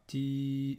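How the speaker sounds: "ti" with a long held i; prolonged vowel. Shown as a demonstration. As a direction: hold the vowel of "ti" on a level note. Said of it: "la prolongation longue".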